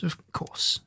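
breathy whispered repeat. Acted. Doctor reassuring the Push-Me-Pull-You, whispering. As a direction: whisper it to yourself. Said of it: "said the doctor".

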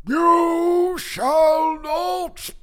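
very slow, loud, high wizard cry; drawn-out roar, dropping on the last word; Gandalf. Acted. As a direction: bellow each word like a defiant wizard. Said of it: "You shall not pass"? That's the, that's Gandalf".

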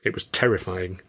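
ordinary delivery, nothing marked

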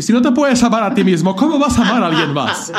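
high, loud campy diva voice. Retold or imitated. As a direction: preach it like a drag queen. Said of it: "Ay, yo de [name]".

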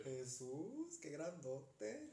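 slow, drawn-out mock-spooky delivery; podcast ghost line. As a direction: drag out grandote like a creepy podcast. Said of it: "como minuto dieciséis".

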